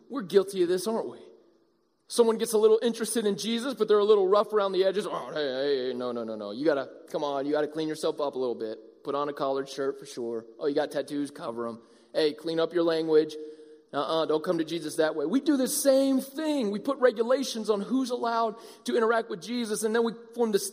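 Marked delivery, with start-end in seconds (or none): 5.0-15.3 lower, softer scolding voice; lightly voiced fussy church member; mock gatekeeping churchgoer. Churchgoer lecturing a newcomer, a lightly voiced lower scold. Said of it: "we play this game too".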